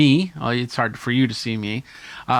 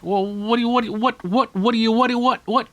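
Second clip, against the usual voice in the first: higher, tripping over the words; flustered stammering question; 9 semitones higher, faster than usual. Acted. Host fumbling for a question mid-interview, mock flustered. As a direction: stumble for the question in mock panic. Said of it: "It's like".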